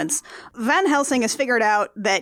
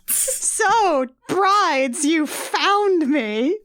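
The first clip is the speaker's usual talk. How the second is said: high, slow put-on heroine voice; hammed-up damsel greeting; mock damsel; 5 semitones higher, much slower than usual. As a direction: drawl it like a swooning victim. Voicing Anna meeting the brides.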